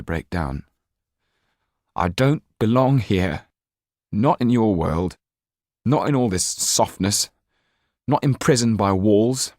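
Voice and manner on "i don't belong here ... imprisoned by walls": louder, strained protest; Jan protests. Acted. Jan insists to Mrs Laxton he doesn't belong, louder. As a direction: hold back tears and push back.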